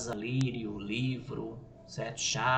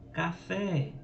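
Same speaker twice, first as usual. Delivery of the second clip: café, higher, with a strong final fé; stress on FÉ; 5 semitones higher than usual. Shown as a demonstration. Teacher giving an oxytone example, stress on the fé of café. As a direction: make the last syllable of café the strong one. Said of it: "a última sílaba é tônica".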